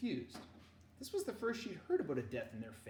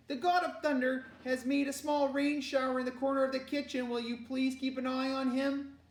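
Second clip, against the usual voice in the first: high, loud, fussy instruction; exasperated mother; 10 semitones higher, 7 dB louder than usual. Acted. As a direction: call out orders in a raised voice. Mother calling to daughter.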